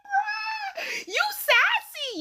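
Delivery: laughing shout. Retold or imitated. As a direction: yell it through a laugh. Teasing sassy coworker.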